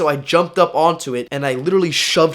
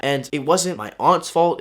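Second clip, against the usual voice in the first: ordinary delivery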